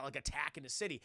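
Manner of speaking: ordinary talk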